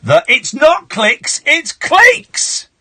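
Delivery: slow, high, loud scolding; angry screaming. Pretend. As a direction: scream the correction in their face. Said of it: "scream in their face".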